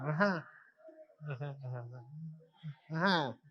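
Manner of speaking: soft, offhand ajá, ajá, like brushing someone off; dismissive ajá. Shown as a demonstration. Teacher showing students an ignoring ajá, soft and dismissive. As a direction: mumble ajá as if not listening at all. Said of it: "pero como que no me pelan".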